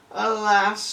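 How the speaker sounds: a long, loud, despairing "Alas"; despairing sigh. Acted. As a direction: cry out in defeat at the well. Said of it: "she said".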